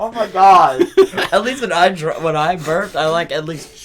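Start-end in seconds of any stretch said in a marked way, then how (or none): 0.0-1.9 high, loud laughing exclaim; laughing loudly. Real, not acted. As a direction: blurt it out while cracking up. Cracking up at burping friend.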